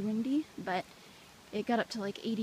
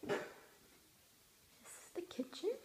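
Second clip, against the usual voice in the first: soft whisper, higher; 7 semitones higher, 10 dB quieter than usual. Real, not acted. Touring her host family's house, whispering. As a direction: breathe it out so nobody in the house hears.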